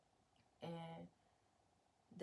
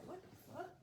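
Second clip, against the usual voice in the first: surprised; 12 semitones higher, 5 dB quieter, faster than usual. Real, not acted. Startled by something on the bag.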